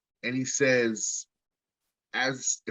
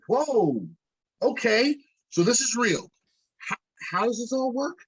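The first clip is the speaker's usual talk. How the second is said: surprised; 7 semitones higher than usual. Retold or imitated. Surprised reaction to friend.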